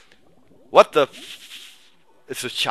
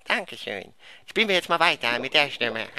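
silly voice; 3 semitones lower than usual. Pretend. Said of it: "die Stimme ist scheiße".

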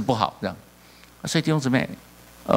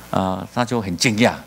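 usual speaking voice

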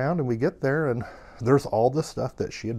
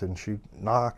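ordinary delivery, nothing marked

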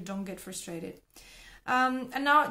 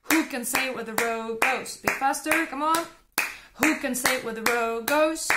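louder and faster each round; building speed and volume; 3 semitones higher, 7 dB louder than usual. Shown as a demonstration. Teacher drives learners faster in a rhythm drill. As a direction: push the pace harder.

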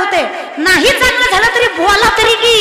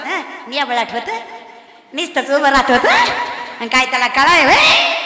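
mock critic; 6 semitones lower than usual. Preacher mimics people complaining her kirtan was dull, low and grumbling. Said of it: "लोक म्हणतात".

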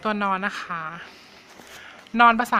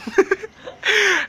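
a burst of high, real laughter; 12 semitones higher, 4 dB louder than usual. Real, not acted. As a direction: crack up at your own silly joke. Laughs at her own nonsense. (หัวเราะเสียงสูงออกมาจริงๆ)

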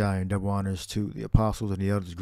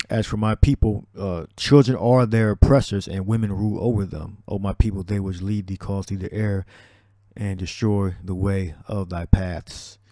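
loud, measured scripture reading; 6 dB louder than usual. Acted. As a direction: read it out like a preacher at the pulpit. Reads scripture aloud in preaching voice.

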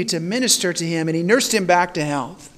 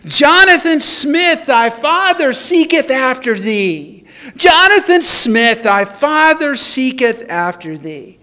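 loud calling out; 5 semitones higher, 6 dB louder than usual. Retold or imitated. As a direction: call the name out long and loud into the dark. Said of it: "call out loudly over and over".

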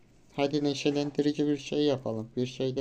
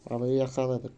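ordinary delivery

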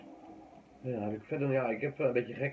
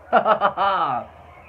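loud laugh; 6 semitones higher, 13 dB louder than usual. Real, not acted. Laughs at his outfit.

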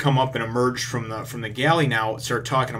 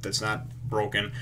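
normal talking voice

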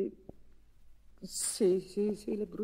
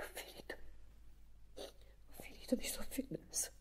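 breathless whisper after crying; 17 dB quieter, faster than usual. Acted. To her lover after breaking down, a spent whisper. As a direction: whisper it, pulling yourself together. Recovering from tears on phone.

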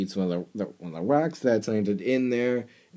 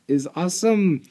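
higher echo of awesome; imitating catchphrase; 11 semitones higher, 7 dB louder than usual. Viewer echoing Rainbow Dash's catchphrase, higher. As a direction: repeat it back in the pony's voice. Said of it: "Rainbow Dash's catchphrase".

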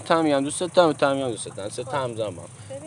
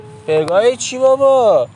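shocked; 7 semitones higher, 6 dB louder, much slower than usual. Real, not acted. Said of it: "درست صحبت کنید".

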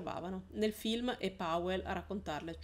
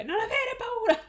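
much higher and louder, a full shout; 8 dB louder than usual. Acted. Adult Lara shouts to her younger self, full shout. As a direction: yell it across the fire. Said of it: "le gridò la Lara adulta".

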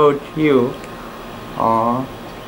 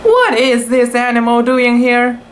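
higher, indignant startled question; startled cry; 7 semitones higher than usual. A narrator's reading. Tai Tai finding a kitten in the paddock, startled cry. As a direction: sound startled and put out. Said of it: "cried Tai Tai".

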